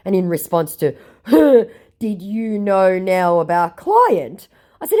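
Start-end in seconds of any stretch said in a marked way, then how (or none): 1.3-4.5 slow, a little higher put-on accent; mock scammer voice. Repeating the scammer's question, slow and put-on. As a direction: drawl it warily. Quoted again.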